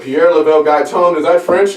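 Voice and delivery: lightly voiced officer asking in confusion; puzzled police officer. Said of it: "he was like".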